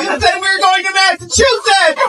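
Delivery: very high, screeching mock outrage. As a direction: shriek it like a hammed-up jilted lover.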